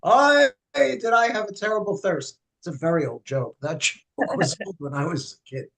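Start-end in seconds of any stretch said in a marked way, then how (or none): 0.0-2.4 put-on a whiny old man crying out for water; kvetching old man. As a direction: groan it like a parched old man. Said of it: "the little old man goes".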